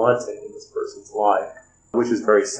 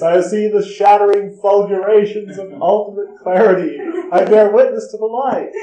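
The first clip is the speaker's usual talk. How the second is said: high, loud prophetic raving; raving madman; 10 semitones higher, 5 dB louder than usual. Acted. Man raving on the staircase, high impassioned prophecy. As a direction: rave it like an inspired madman. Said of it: "he's raving on the staircase".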